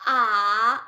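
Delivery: "ah" sinking, then rising slightly; dipping third tone. Shown as a demonstration. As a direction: dip a long "ah" down and back up. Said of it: "the tones again".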